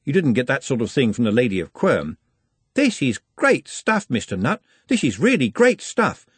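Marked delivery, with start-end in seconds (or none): 2.7-6.3 higher, slowed, stress on "great"; lightly voiced lad, amazed; impressed Trev.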